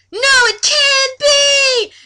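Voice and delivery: high, slow, loud gasp of horror; mock shock. Laura seeing the Dark Lord, high and slow. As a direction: ham up the horror slowly.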